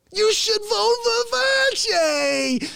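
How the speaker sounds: high, slow urging voice; put-on voice. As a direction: coax them high and slow into voting Fauci. A voice urging vote for Fauci.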